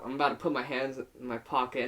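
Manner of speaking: normal talking voice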